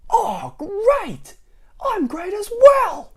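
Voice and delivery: very high, loud, slow yelling; high shouted reply. Acted. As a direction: bellow the happy reply. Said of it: "They'd shout as they met".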